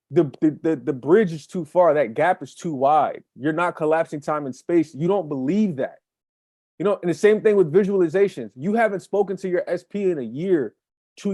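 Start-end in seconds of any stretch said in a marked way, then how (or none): none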